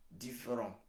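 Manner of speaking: ordinary talk, nothing marked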